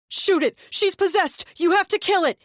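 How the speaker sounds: high, loud screamed order; frantic shout. A narrator's reading. Deluded brother screaming at Angel to shoot. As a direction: scream the command. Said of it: "Nathan shouted".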